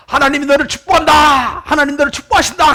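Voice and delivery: loud, high acted boy's call; shouted blessing. Boy shouts a blessing from the hill, loud and high. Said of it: "다시 이야기합니다".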